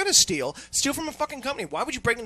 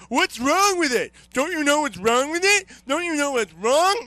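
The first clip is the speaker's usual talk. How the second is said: mocking nagging user; 8 semitones higher, 6 dB louder than usual. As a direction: whine it like a pestering caller.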